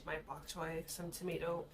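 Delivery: usual speaking voice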